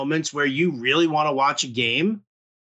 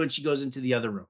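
ordinary delivery

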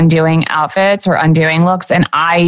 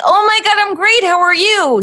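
much higher, gushing over-bright greeting; bubbly fake cheer; 12 semitones higher than usual. Mocking the expected chirpy answer to a friend, much higher. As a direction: squeal it like a too-happy friend. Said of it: "you can't be like".

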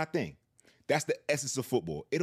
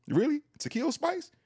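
pitched way up in fake shock; mock disbelief; 12 semitones higher than usual. Teasing his co-host about complaining, in mock high-pitched disbelief. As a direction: sell it like you cannot believe what you just heard.

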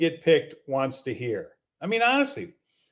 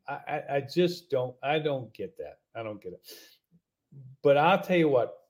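usual speaking voice

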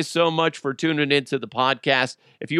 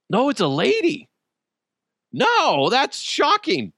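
high, loud, stunned disbelief; real shock, voice jumping way up; 12 semitones higher, 3 dB louder, slower than usual. Real, not acted. Host reading the article, shocked it's a woman.

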